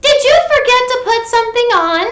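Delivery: high, loud calling mom. Acted. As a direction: call out to Froggy from the door. Mom calls Froggy.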